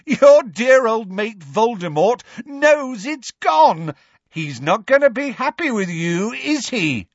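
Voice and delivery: high, loud mocking jeer; manic taunting. A narrator's reading. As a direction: rub it in with wild glee. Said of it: "with a mad laugh".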